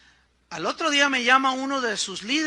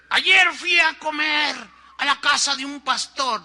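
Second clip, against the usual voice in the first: preaching from the pulpit; 5 semitones higher, 7 dB louder than usual. Retold or imitated. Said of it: "¿Qué crees que predicó el pastor?".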